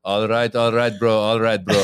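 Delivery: put-on joking Armenian-accented catchphrase; mock a film-star catchphrase in an Armenian accent. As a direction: Armenian accent, punchy and teasing. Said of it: "kind of an Armenian version".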